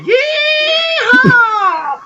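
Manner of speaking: very high, long, loud cowgirl holler; full-force Southern whoop; sudden yeehaw holler. Retold or imitated. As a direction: let rip a piercing yeehaw out of nowhere. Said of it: "just out of nowhere, was like".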